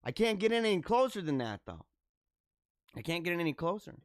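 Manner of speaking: normal talking voice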